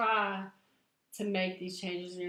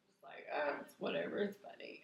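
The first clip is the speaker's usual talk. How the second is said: breathy aside; 9 dB quieter, faster than usual. Real, not acted. Winding down after laughing fit.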